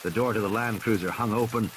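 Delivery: ordinary delivery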